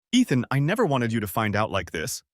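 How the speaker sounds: usual speaking voice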